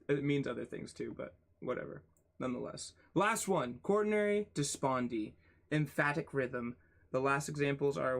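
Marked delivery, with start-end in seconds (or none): none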